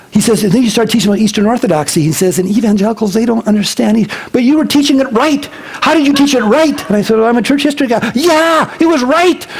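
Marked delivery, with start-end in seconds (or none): 2.4-9.4 high, animated friend; eager praise. Retold or imitated. Friend asking how the teaching came out right, high and eager. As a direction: praise it with wide-eyed wonder.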